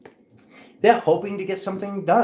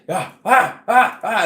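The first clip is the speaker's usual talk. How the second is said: joyful ah sounds; 6 semitones higher, 7 dB louder, slower than usual. Pretend. Mimicking joy, being lit.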